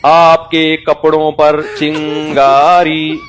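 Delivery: very slow, drawn-out warning; slow tajweed recitation. Retold or imitated. Pupil slowly warning teacher his clothes are burning. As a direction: recite it like Quran lesson, no hurry. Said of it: "पूरी तजवीद के साथ ना".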